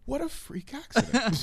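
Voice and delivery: high mock disbelief; incredulous quote. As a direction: exclaim it in mock shock. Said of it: "because you're like".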